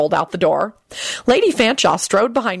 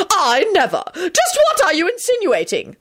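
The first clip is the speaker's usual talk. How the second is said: very high, affronted lady's voice; shrieking outrage; 12 semitones higher than usual. A narrator's reading. Lady Fanshawe denying the insinuation, shrill outrage. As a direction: protest in a scandalised high shriek. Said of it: "she shrieked".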